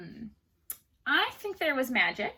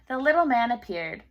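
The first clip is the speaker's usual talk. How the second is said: ordinary delivery, nothing marked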